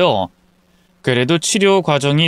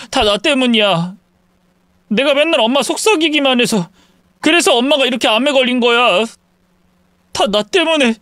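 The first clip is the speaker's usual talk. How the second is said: very high, tearful outburst; anguished, crying son; sobbing self-blame; 9 semitones higher than usual. Acted. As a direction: blame yourself through sobs. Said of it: "엉엉 우는 아들".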